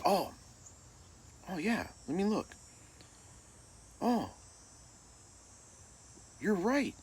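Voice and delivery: high-pitched, halting coach; imitated sheepish backpedal, long pauses; coach realizing mistake.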